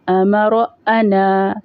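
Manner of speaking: امر، انا drawn out slowly and joined smoothly; slow linked reading. Shown as a demonstration. Teacher reads the words joined for children, slowly. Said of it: "روانی کے ساتھ".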